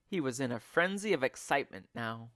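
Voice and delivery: plain reading voice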